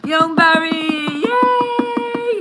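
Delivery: high, drawn-out celebratory whoop; excited welcome cheer. Real, not acted. Host welcoming a guest onto the show, excited cheer. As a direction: cheer the guest in, pitch soaring.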